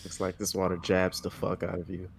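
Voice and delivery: ordinary talk, nothing marked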